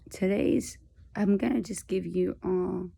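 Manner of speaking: ordinary talk, nothing marked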